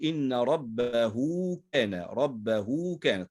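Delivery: slow recitation with "ربه" stretched to rabbahuu; pronounced waw. Shown as a demonstration. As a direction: draw out the hu of "ربه" before "كان". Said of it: "وننطق واوا".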